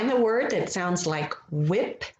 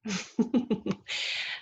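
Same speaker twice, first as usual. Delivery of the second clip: light run of short giggles; soft chuckle; 3 semitones higher, 4 dB quieter than usual. Real, not acted. Amused by the page.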